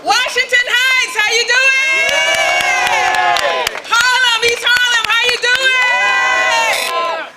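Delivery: loud, high, slow rally greeting drawn out; shouting to the crowd. Real, not acted. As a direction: call out to the whole crowd, stretching each greeting.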